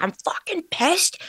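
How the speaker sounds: high, put-on voice for the furious ego; a high mock-angry ego voice. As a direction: squeak out the ego's anger in a high voice. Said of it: "ego's like".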